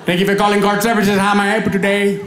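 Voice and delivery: put-on Indian call-centre accent, repeated. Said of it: "customer service representative".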